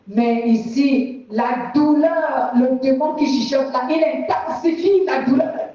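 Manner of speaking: ordinary talk, nothing marked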